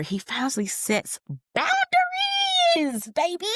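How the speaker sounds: high, slow sing-song squeal of pride; cheering falsetto. Real, not acted. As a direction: stretch it up high like a proud cheer. Cheering the character's growth.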